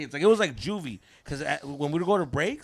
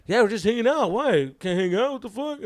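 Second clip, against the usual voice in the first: retold fake innocence; 9 semitones higher, 8 dB louder than usual. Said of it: "we're fucking terrible liars".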